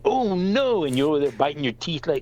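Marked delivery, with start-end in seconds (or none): 0.1-0.8 high, guilty oh-no; mock dismay. Thrower realizing the kid got hit, dismayed. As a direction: imitate someone alarmed at their own mistake. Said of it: "And you were like".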